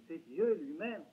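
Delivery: normal talking voice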